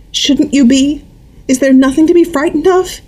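high pleading questions; a hint of a scared mother; frightened mother, lightly voiced. A narrator's reading.

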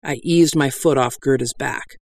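ordinary delivery, nothing marked

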